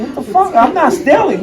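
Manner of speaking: high, loud mock indignation; emotional protest. Ex-prisoner followed by a store robot, comically indignant. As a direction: protest your innocence, high and half laughing. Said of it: "I was real emotional".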